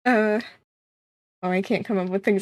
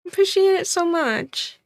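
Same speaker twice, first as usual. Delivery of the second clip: excited; 9 semitones higher than usual. Real, not acted. Excited thanks to a gift sub.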